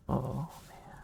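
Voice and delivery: normal talking voice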